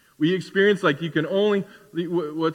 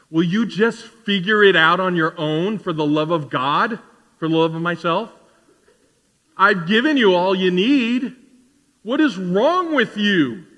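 mock exasperation. God to the believer who keeps coming back, acted exasperation. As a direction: build like patience finally snapping.

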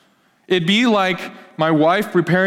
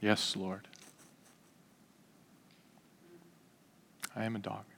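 meek soft-spoken supplicant; humble pleading woman; 9 semitones lower, 11 dB quieter than usual. Acted. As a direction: plead gently, head bowed. Preacher voicing the humble woman.

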